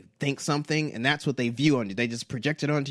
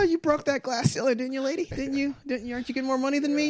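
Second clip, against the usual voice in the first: much higher, sing-song mocking needle; sarcastic taunt; 11 semitones higher than usual. Needling a woman about her promotion in a high sing-song. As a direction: tease her like a smug sibling.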